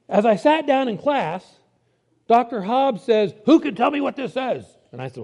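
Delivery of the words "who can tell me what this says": quizzing professor. Retold or imitated. Professor quizzing the class, retold.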